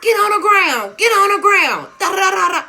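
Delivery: high, loud barked cop order; police command. Retold or imitated. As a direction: yell like a cop making an arrest. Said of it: "here come the motherfucking laws talking about".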